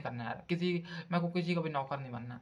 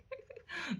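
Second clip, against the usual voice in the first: high laugh; 13 semitones higher, 10 dB quieter than usual. Real, not acted. Narrator laughs at own story.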